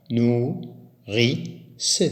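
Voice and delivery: Nourrice said slowly as nou-rrice, each syllable apart; split into syllables. Shown as a demonstration. Teacher segmenting the word for pupils, syllable by syllable. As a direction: break Nourrice into its two syllables. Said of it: "on peut le segmenter en syllabes".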